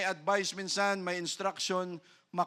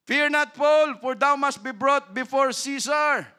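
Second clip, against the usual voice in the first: preacher thundering God's comfort; loud preaching; 9 semitones higher, 9 dB louder than usual. Acted. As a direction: voice of God booming high and loud.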